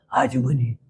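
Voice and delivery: deep, hushed, menacing call; driver's low voice. Retold or imitated. Taxi driver lowering his voice to the lone woman passenger, low and hushed. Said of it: "음성을 탁 깔아차가지고".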